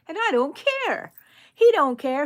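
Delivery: playful defiance. Real, not acted. Playful defiance about mistake.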